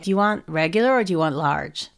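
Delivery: low American salad-server voice; be the American server, low and unbothered; convincing American accent. Retold or imitated. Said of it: "That was good".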